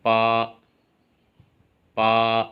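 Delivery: पा held level on one pitch; पा in Mandarin first tone; flat first tone. Shown as a demonstration. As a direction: keep पा flat and steady from start to end. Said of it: "तो इसकी sound हो जाएगी".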